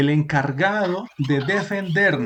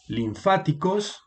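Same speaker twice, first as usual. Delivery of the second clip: "linfáticos" slow and higher, stressed lin-FÁ-ti-cos, rising at the end; correct stress on FA; 5 semitones higher, slower than usual. Shown as a demonstration. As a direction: model "linfáticos" slowly with FA stressed, leaving it open. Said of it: "aquí nos está indicando el acento va aquí". («linfáticos» lento, marcando FA: linFÁticos)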